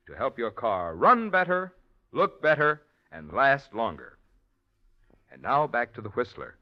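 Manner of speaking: normal talking voice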